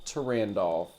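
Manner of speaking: normal talking voice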